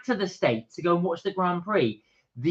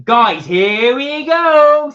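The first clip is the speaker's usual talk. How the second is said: loud, slow call to viewers; 11 semitones higher, 11 dB louder, much slower than usual. Real, not acted. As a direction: stretch it out loud.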